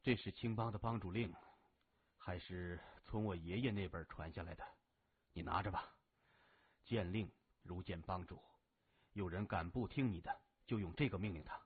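earnest request from a sickbed, low and soft; a grave older brother handing over duty; Gao Zhen, serious and earnest. Acted. Gao Zhen hands over token.

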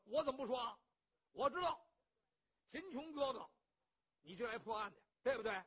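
a boisterous hothead boasting; rough loud bandit. Acted. Cheng Yaojin tells Qin Qiong he saved him, brash and loud.

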